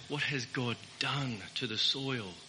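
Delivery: mild searching rich man asking what God wants of him; humble questioning man. Retold or imitated. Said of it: "the man should have said".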